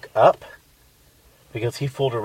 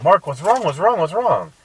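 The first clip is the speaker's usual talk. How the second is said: high, loud frantic repeats; panicked, trying to rouse him; alarmed pleading; 9 semitones higher, 7 dB louder than usual. Retold or imitated. Shaking her screaming boyfriend awake, frantic and high.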